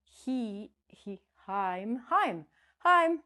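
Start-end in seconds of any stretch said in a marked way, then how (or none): 1.4-3.2 "Heim" rhyming with time, higher and louder; wrong long vowel. Shown as a demonstration. Teacher mocking a mistake for students, "Heim" with long I. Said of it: "We don't say".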